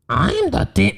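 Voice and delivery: high, half-hearted demon voice, breaking off; attempted demon voice. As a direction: have a weak go at a demon voice. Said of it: "Try a demon voice".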